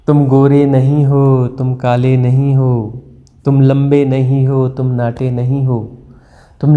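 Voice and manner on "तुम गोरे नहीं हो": usual speaking voice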